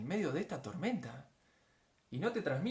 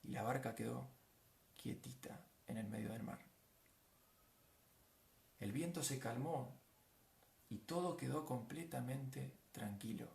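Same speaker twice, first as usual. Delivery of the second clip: hushed calm; 2 semitones lower, 10 dB quieter than usual. Acted. Preacher describing the stilled sea, hushed calm. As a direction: settle into quiet, leaving room between phrases.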